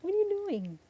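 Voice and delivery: high, cooing voice for a cat; pet talk voice. Real, not acted. Talking to the cat.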